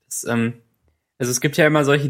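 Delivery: normal talking voice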